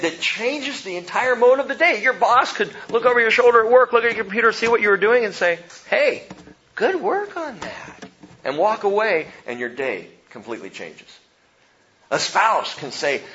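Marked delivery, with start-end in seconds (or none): 5.9-8.0 boss's praise, higher and slower, a little removed; imitating a boss's praise. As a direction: play the boss tossing a quick compliment over your shoulder. Boss praising employee at work.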